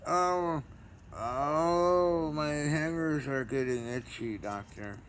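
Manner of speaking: high, loud hammed-up moans at the doctor; mock moaning patient. Pretending a patient moans to his doctor, hammed-up. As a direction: overplay a moaning patient, drawn out. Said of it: "it's just me moaning".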